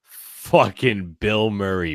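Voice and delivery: ordinary delivery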